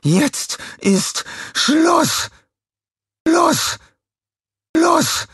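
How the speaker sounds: very high, slow enraged shrieks; furious shouting. A narrator's reading. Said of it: "stinkwütend".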